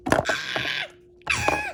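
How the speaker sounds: two very high shrieks; pained screams. Pretend. Child having hair pulled, high screams. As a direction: shriek sharply as if hurt.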